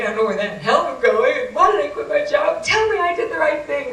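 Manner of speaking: frantic outburst, rising high and loud; screaming. Retold or imitated. Distraught driver to psychic friend on the phone, screaming. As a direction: plead at full pitch, desperate for reassurance. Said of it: "I'm screaming".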